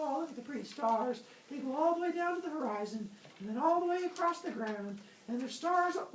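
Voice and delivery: high, climbing sing-song musing; dreamy admiration. Acted. Zeus checks out the stars, dreamy high musing. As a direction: marvel idly in a rising voice.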